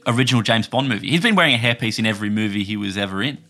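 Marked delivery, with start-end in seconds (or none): none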